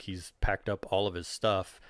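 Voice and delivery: normal talking voice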